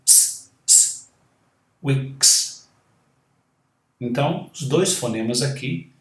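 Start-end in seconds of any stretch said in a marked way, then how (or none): 0.1-1.1 a long hissed s with no voice; unvoiced s demo. Shown as a demonstration. Teacher showing students a voiceless hiss. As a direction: hiss the s twice, throat still. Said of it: "E o S também não".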